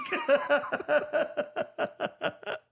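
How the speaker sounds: long, high cackling laugh; helpless laughter. Real, not acted. Host tickled by their own Elon Musk joke, laughing hard. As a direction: crack up in a long high giggle.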